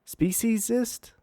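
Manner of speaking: pitched high and slow, Speciesist? as a stunned question; can't believe the word he just read; incredulous. Real, not acted. Host repeating PETA's word in disbelief. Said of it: "Oh my lord".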